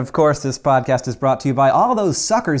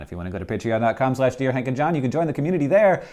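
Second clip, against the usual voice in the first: rushed plug; much faster than usual. Real, not acted. Rapid Patreon plug.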